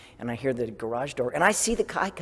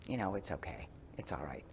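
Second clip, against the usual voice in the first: whispered, much softer reassurance; gentle self-comfort; 10 semitones lower, 17 dB quieter than usual. Retold or imitated. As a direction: soothe yourself in a hush. Soothing herself after screwing up.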